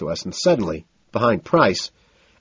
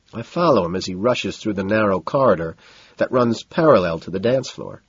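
plain reading voice

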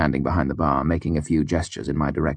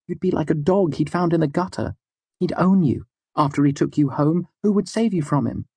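heated; 12 semitones higher than usual. A narrator's reading. Denner's heated lecture to girl.

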